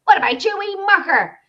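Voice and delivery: high, loud Northern Irish greeting; hearty mock Northern Irish accent; Aidan. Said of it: "he calls me".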